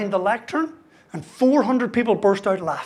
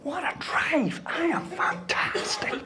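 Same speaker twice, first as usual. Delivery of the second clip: smug whispered boast to himself; inner whisper; 6 semitones higher, 9 dB quieter, slower than usual. Retold or imitated. Golfer gloating to himself after a big drive, whispered. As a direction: whisper it, gloating inside your head. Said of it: "I'm thinking".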